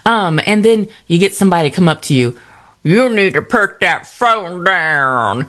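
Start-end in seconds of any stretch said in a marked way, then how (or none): 2.8-5.5 slow, drawn-out mush-mouthed mimicry; mock a meddling stranger, dragging out the words; mocking busybody. Somebody comes up to scold you for posting, mocking busybody. Mocking people who approach her.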